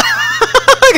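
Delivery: high burst of laughter. Real, not acted.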